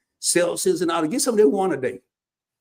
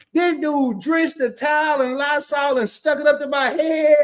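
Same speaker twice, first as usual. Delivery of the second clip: outraged telling; 7 semitones higher than usual. Retold or imitated. Outraged retelling of Lysol towel.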